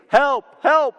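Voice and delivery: high cries of "Help!"; mock yell from a man hanging off a cliff; retold scream for help. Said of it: "He starts screaming".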